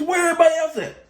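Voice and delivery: high, sharp mock boss voice; boss calling out. Supervisor asking the inmate what he's doing, in a high mock voice. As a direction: call across the kitchen like a puzzled supervisor. CEO asks where others are.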